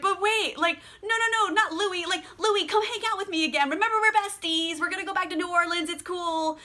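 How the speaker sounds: high, quick mock wheedling; deluded pleading. Lestat coaxing Louis to be besties again, deluded pleading. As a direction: plead as if everything is still fine. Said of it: "You know, just his delusion".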